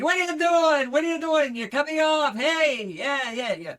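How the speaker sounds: hammed-up high, loud cabbie shouting; shouty mock taxi-driver tirade; New York taxi driver. Shown as a demonstration. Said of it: "a taxi driver in New York City".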